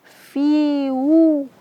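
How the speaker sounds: "Fi" held two beats, then "wu" with rounded lips; long fi. Shown as a demonstration. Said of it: "panjangnya dua harakat".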